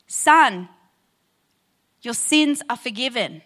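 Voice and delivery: grave, measured pronouncement of forgiveness; solemn Jesus quote. Acted. Pastor voicing Jesus to the paralyzed man, solemn and measured. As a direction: let the words land with weight.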